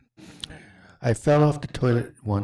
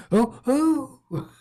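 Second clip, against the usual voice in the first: high, louder mock "oh" cries; imitated startled cries; 14 semitones higher, 5 dB louder than usual. Host imitating Tracy's reaction to falling, high mock cries. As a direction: mimic her helpless yelps way up high. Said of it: "the way she was like".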